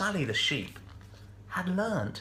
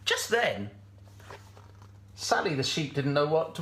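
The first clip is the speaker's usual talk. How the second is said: plain reading voice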